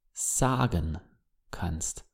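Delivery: soft whispered repeat; whispered echo. Shown as a demonstration. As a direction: echo the words in a breathy whisper. Phrase echoed as whisper.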